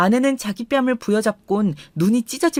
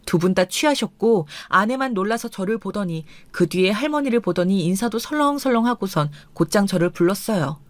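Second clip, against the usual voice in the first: ordinary delivery, nothing marked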